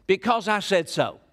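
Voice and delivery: a hint of a stern parent laying down the law; lightly voiced fed-up parent shutting down the question; parent's stock answer. Preacher voicing a parent to the kids, lightly stern. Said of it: "How many of you have ever said this to kids?".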